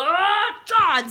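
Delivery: loud, high straining yell; Thor's roar. Retold or imitated. Thor landing with lightning, raw roar. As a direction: roar it as you slam down. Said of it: "he goes".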